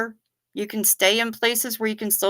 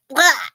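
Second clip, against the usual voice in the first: higher-pitched mock splutter; 6 semitones higher than usual. Snorkeler who breathed in water, mock sputtering cry. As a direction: act out spitting out a mouthful of seawater. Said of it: "And you go".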